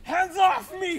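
shrill high squeal of protest; high-pitched protest. Acted. Employee dragged out by security.

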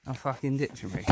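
ordinary talk

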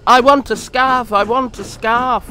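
high, loud, chugging chant; puffing little engine. A narrator's reading. Percy still puffing his scarf chant as he leaves. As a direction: puff it out in rhythm. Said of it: "still puffing".

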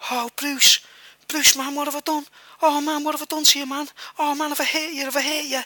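high, pleading retold panic; panicked apology. Attacker horrified at cutting his friend, high pleading panic. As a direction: play the attacker suddenly horrified at what he did. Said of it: "and then he starts going".

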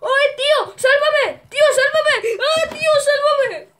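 high, loud desperate screams; screaming for help. Pretend. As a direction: scream to be rescued. Begging to be saved in game.

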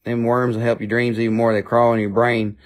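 ordinary delivery

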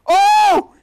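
very high, loud sudden yelp; startled shriek; surprised cry. Real, not acted. Gamer spots the real Dunson, surprised cry.

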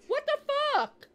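rising high, loud disbelief; shocked shout. Real, not acted. Shocked by strange game scene.

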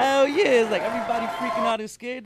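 high, loud, fired-up boast; hyped shout. Real, not acted. Rapper to his co-host, hyped and shouting. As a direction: shout it like a battle cry. Hyped rant about thriving broke.

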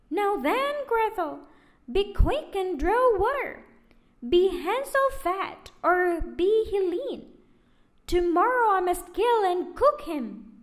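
high, loud, impatient witch voice. A narrator's reading. Witch losing patience, ordering Gretel, high and loud.

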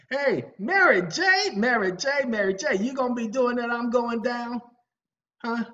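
sing-song call across the room. Real, not acted. Speaker calling to [name] to get her singing, high loud call.

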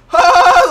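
high, loud wailing "oh"; uncontrolled cry, reenacted. Man shaking violently under the voice, loud high involuntary cry. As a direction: burst out as if you can't control it. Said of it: "I can't even control it".

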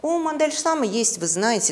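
normal talking voice

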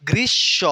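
গ্রীষ্ম split as "grish... sho"; long hushing sh in গ্রীষ্ম, no m; double sh. Shown as a demonstration. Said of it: "দুইটা স". (গ্রীষ্ম ভেঙে "গ্রিশ্-শোঁ")

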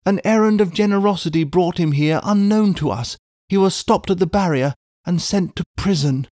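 high, agitated and rushed; words tumbling out in distress; distraught. A narrator's reading. Distraught wife explains the arrest.

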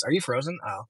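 ordinary talk, nothing marked

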